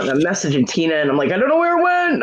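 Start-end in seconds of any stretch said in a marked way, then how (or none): 1.3-2.2 high-pitched panicked wail; freaking out. Retold or imitated. Woman retelling messages to her friend about a lost package, freaking out. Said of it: "and I'm freaking out".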